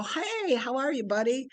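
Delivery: cheery greeting. Retold or imitated. She greets the Imagineer at the party, high and cheery.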